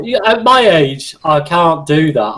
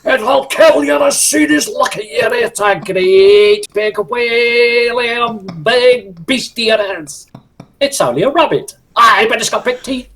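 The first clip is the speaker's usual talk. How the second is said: put-on high, Scottish-accented magician voice; dramatic Scots warning about the beastie; doing the Holy Grail magician, high Scottish warning voice; Tim the Enchanter; 8 semitones higher, slower than usual. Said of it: "I can do the magician".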